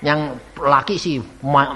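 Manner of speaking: usual speaking voice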